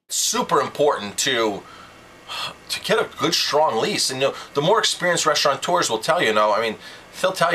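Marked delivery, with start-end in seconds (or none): none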